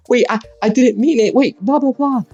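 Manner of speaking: high, hurried mock apology; apologizing tsundere. Tsundere girlfriend tries to take it back, high flustered mock apology. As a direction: pitch up into a flustered girl's backpedal. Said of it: "now she's like".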